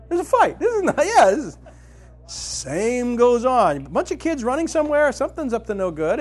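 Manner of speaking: high, loud, driving sermon delivery; impassioned preaching. Acted. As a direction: preach it full tilt to the crowd. Preaching at full force.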